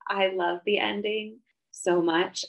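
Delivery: ordinary delivery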